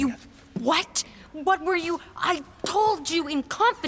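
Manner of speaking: a betrayed friend rounding on her confidant; upset. Acted.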